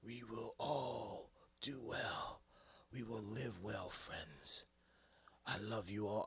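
slow, soft, solemn send-off; imagined wise farewell. Retold or imitated. Fan imagining Iron Man's dying words, slow and soft. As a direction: drift it out like final wisdom. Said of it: "I thought he was gonna say".

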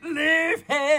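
shouted high leave him; mock command. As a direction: blurt it out as a joke. Interjecting advice.

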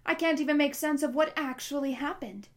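loud and exasperated. A narrator's reading. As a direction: keep the volume up, baffled. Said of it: "so loud she made both Connor and Miss Kwan jump".

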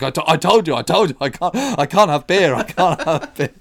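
hammed-up cry of I can't have beer; mock protest. Carpenter protests to boss, mock loud pleading.

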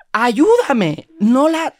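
hammed-up cry for help; mock desperate plea. Stranded narrator begging the Thai woman for help. Pleading in retold crisis.

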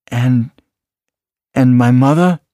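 hesitate, then ask with fragile hope; tentative hope. A narrator's reading. Salva asks after his mother, barely daring to hope. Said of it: "barely daring to hope".

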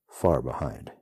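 ordinary delivery

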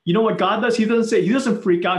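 ordinary talk

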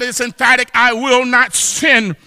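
ordinary talk, nothing marked

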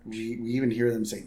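normal talking voice

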